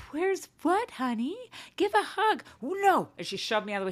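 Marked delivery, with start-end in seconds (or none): 0.1-2.4 high sugary motherly voice; sweet mom voice. Retold or imitated. Mom greeting daughter, high sugary coo. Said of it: "I was like". 2.6-3.1 put-on high, firm child no; child refusing. Daughter refusing mom's hug, high child no. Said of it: "daughter refusing hug".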